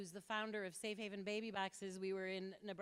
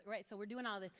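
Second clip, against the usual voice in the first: ordinary talk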